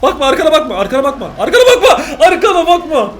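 loud, high, laughing shout; laughing yell. Real, not acted. Viewer warning the character not to look back, loud laughing shout. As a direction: yell the warning while cracking up.

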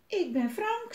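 put-on high little-boy voice. A narrator's reading. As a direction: speak up high as a small child. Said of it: "zegt het jongetje". (hoog jongensstemmetje)

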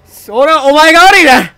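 hammed-up loud self-defence; mock indignant yelling. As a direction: blame the enemy loudly, half joking. Shouting blame at the zombie.

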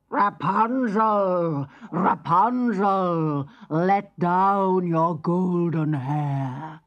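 loud, slow, gruff witch calling up the tower. Acted. Witch calling up to Rapunzel in a gruff voice. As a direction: bellow it slowly like an old witch. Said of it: "she called in her gruff voice".

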